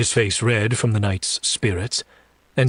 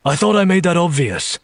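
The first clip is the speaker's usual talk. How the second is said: raised, higher-pitched retort; exasperated retort; 8 semitones higher, 4 dB louder than usual. Acted. Demon answering the shocked woman, exasperated. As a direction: throw it back at her, pitched up.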